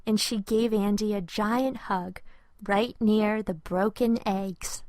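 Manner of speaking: usual speaking voice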